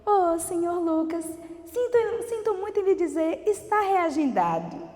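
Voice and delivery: um toque de voz mais aguda e lenta de atendente; voz da moça do balcão. Retold or imitated. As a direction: imita a atendente se desculpando, devagar. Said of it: "a moça falou". (atendente imitada, mais aguda e pausada)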